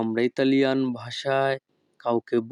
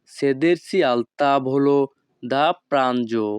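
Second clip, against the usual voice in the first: each Italian word spoken slowly and clearly; teacher modelling Italian for learners, very slow; slow demo; much slower than usual. Shown as a demonstration. Bengali teacher models Italian phrase for students, slow and careful. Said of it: "তখন আমাদেরকে বলতে হবে".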